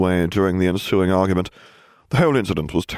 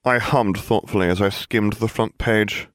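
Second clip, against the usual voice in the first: ordinary delivery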